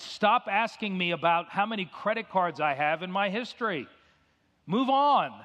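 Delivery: losing patience with the clerk, rising to a yell; angry. Retold or imitated. Telling the bank clerk on the phone, getting angry. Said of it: "I'm sorry I got angry at you".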